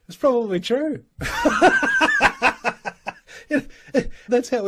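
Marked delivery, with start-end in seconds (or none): 0.1-1.1 laughing as he says it; wry laugh at a grim punchline. Real, not acted. Laughs at own joke. 1.1-3.1 real high laugh; laughing at his own grim joke; burst of laughter. Real, not acted.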